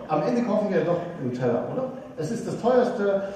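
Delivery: ordinary talk, nothing marked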